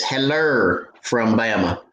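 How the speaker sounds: Heller drawn out slowly, HEL-er with an r ending; imitating Bama slang. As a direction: savor the Alabama Heller for hello. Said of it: "That's that Bama slang".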